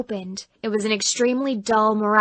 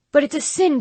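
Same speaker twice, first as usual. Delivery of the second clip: higher, louder pleading; overacted churchy objection; hammed-up protest; 6 semitones higher, 4 dB louder than usual. Boy actor protests.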